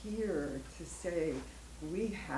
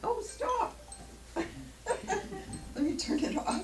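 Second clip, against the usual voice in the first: startled; 12 semitones higher, much slower than usual. Real, not acted. Startled by something going off.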